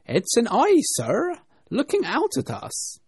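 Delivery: high servant reporting loudly; servant's voice. A narrator's reading. Servant answers the rabbit master.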